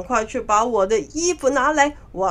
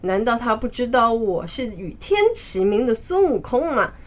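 plain reading voice

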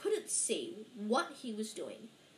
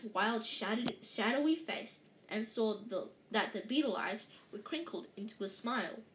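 ordinary delivery, nothing marked

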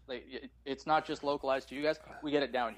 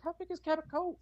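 put-on childlike question; 13 semitones higher than usual. Child self asking mom.

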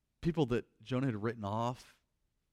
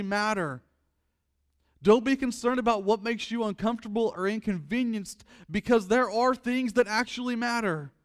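loud, high impassioned preaching; preaching at full force; 9 semitones higher, 7 dB louder than usual. Acted. Preacher pleading with the congregation, loud and high. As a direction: pour it out from the pulpit, loud and high.

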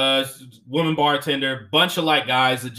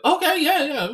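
dismissive "yeah, yeah", pitched way up; mock dismissive; 13 semitones higher than usual. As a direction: brush it off in a high mock voice. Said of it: "then he's like".

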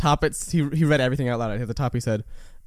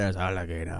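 Louisiana accent; 8 semitones lower, 8 dB quieter than usual. Shown as a demonstration. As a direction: say ALLIGATOR Louisiana-style, with a soft final R. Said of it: "people with a Louisiana accent say".

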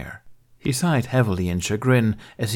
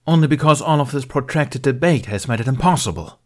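higher, louder irritated snap; snapped; 6 semitones higher, 5 dB louder than usual. Acted. Bale snapping at Tardak over the debate. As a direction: bite the line off sharply. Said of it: "Bale snapped".

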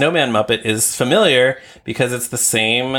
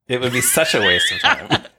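laughing through the words; amused, laughing agreement; 2 semitones higher than usual. Real, not acted. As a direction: agree while breaking into laughter. Laughing agreement about a silly wiki.

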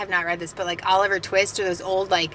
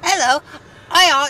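louder, higher Cockney attempt; Cockney accent; 6 semitones higher, 5 dB louder than usual. Co-host attempting Dickens-style speech, halting Cockney accent. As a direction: have another halting go at Cockney. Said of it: "her Cockney accent".

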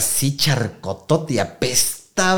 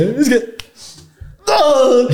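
high wailing no, cracking upward; hammed-up teary wail; mock drunk crying; 13 semitones higher, much slower than usual. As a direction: whimper it like a drunk in tears. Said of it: "empecé a llorar".

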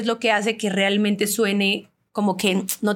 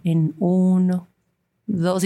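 very slow, calm guided-breathing delivery; slow meditation voice; 3 semitones lower, much slower than usual. Shown as a demonstration. Showing the producer how she had to pace the meditation audio, slow and calm. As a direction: ease each word out as if guiding a breath. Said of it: "ir súper pausada".

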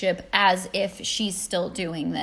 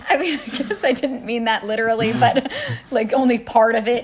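laughing; 5 dB louder, faster than usual. Real, not acted. Laughing at being corrected.

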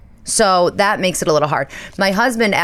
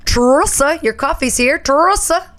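barista calling out; 9 semitones higher than usual. Retold or imitated. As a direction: call the order across the café.

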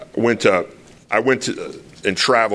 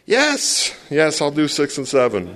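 eager yes; 6 semitones higher than usual. Retold or imitated. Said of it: "I'm all".

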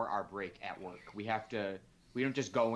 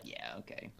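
low, quiet deflated okay; muttered resigned acceptance; 13 semitones lower, 8 dB quieter than usual. Retold or imitated. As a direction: shrug it off, disappointed. Brushed off by sponsors.

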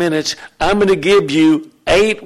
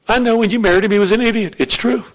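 ordinary delivery

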